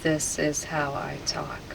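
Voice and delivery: whispered, obedient repetition; entranced echo. Real, not acted. Subject repeating the hypnotist's suggestion, entranced whisper. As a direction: whisper it back as if in a trance.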